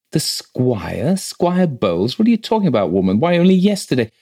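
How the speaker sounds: high-pitched, startled questioning; shocked disbelief. A narrator's reading. Rector hearing the squire is dead, astonished. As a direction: question it incredulously, pitch leaping.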